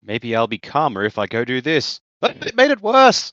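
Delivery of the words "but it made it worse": very high, loud squawk; comic cry of things backfiring; mock wail.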